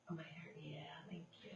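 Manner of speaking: soft whispered thanks; whispered aside. Real, not acted. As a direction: whisper a quick aside off-mic. Aside about hair, whispered.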